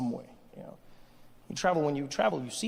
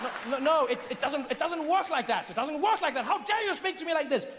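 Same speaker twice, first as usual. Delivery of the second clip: high-pitched, affronted imperial protest; pompous Briton, pitched up in indignation; indignant British colonizer; 9 semitones higher than usual. Acted. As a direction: splutter in outrage as the colonizer. Colonizer indignant at Indian's retort.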